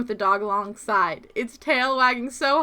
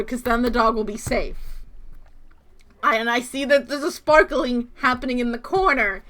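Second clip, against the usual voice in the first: ordinary talk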